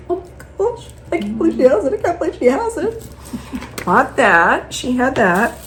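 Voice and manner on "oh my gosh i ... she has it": much higher, thrilled disbelief; imitated giddy excitement; excited squeal. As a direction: gush it like a giddy shopper. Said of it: "I'm like".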